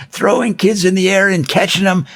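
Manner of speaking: ordinary talk, nothing marked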